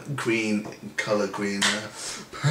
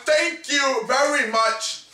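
ordinary talk, nothing marked

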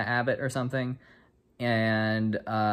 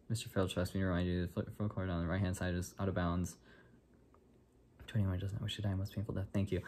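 softer, hurried, muttered reminder; hushed recital; 3 semitones lower, 9 dB quieter, faster than usual. Retold or imitated. Repeating the caretaker's reminder to students, hushed and rushed. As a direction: rattle off the warning under your breath.

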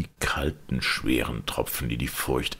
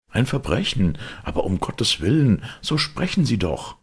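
urgent; 10 semitones higher, 3 dB louder than usual. A narrator's reading. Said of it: "rief der Advokat".

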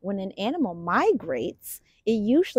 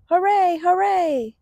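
high, loud hooray; excited cheer for the cleanup crew; 9 semitones higher, 6 dB louder than usual. Acted. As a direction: cheer like a happy crowd.

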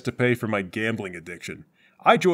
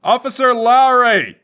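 calling out; 11 semitones higher, 8 dB louder, slower than usual. Acted. Calling for missing partner.

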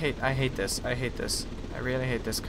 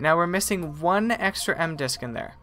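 usual speaking voice

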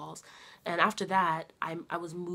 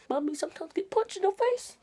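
playful, raised mommy voice; 11 semitones higher than usual. Real, not acted. Baby talk to daughter.

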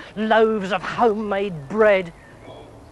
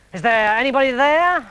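calling out; 4 semitones higher, 4 dB louder than usual. A narrator's reading. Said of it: "Inspector Challoner called out".

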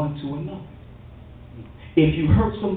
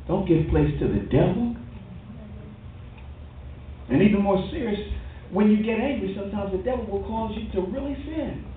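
normal talking voice

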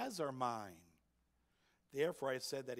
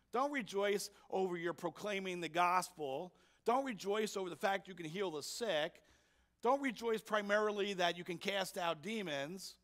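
higher and louder, driving the point; fervent preaching; 6 semitones higher, 5 dB louder than usual. Real, not acted. Preacher exhorting the church to rejoice, raised fervent voice. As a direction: hammer home the main thing.

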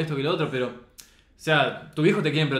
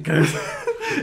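high laugh; 6 dB louder than usual. Real, not acted. Laughs at the Lola surgery joke.

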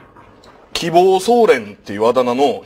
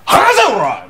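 angry bark; 9 semitones higher, slower than usual. Retold or imitated. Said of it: "もう男が最後の".

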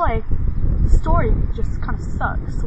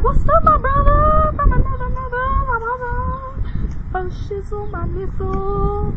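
zombie greeting; 13 semitones higher, 3 dB louder than usual. Pretend. Zombies greet the vlogger as a brother, high bro voice. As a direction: greet like a slangy zombie buddy. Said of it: "they're gonna come and be like".

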